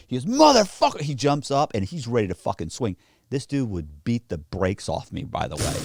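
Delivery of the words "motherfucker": loud, high mock yell of fury; retold angry outburst. Tough guy exploding at friend who used his picture, angry yell. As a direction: imitate a friend exploding in anger.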